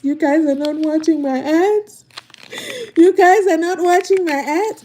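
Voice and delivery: high, loud imitating whine; mocking a crying streamer. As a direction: imitate her tearful complaint, hammed up. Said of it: "she was crying for people".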